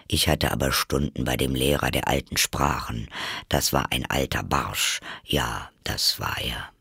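low Gryphon voice, musing; reminiscing Gryphon. Acted. Gryphon remembers his old languages teacher, low and musing. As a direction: drop well down and reminisce.

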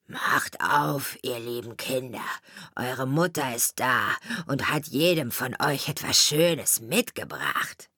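low, rough wolf faking a mother. A narrator's reading. Wolf at the door fakes the mother, rough and low. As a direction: growl it low, pretending to be kind. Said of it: "an der rauen Stimme".

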